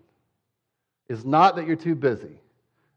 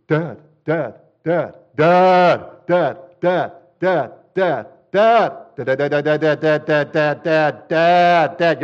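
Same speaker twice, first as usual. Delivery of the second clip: put-on nagging child; 3 semitones higher, 7 dB louder, slower than usual. Tired hungry child nagging dad in the car, insistent whine. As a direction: pester him over and over like a tired kid. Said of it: "they will start poking you".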